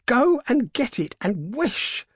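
high, drawn-out urgent command; excited cry. A narrator's reading. Wife ordering husband to fetch paw, quivering with excitement. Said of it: "quivering with excitement".

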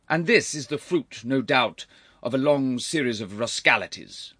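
loud, high accusation; indignant rebuke. Acted. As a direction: accuse the wayward nephew forcefully. Uncle rebukes expelled nephew.

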